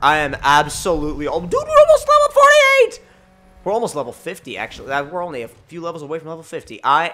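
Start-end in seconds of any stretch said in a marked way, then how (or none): 1.5-3.0 very high, loud burst of excitement; excited shout. Real, not acted. Player noticing how close level fifty is, excited shout. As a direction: shout it at the top of the voice. Sudden excitement at game progress.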